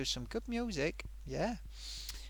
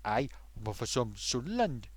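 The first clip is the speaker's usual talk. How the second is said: lower, badly faked Sunderland accent; attempted Sunderland accent; 5 semitones lower than usual. Shown as a demonstration. Streamer mimicking a Sunderland viewer, shaky Sunderland accent attempt. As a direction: have a poor go at a Sunderland accent. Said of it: "I'll try and do it in a Sunderland voice".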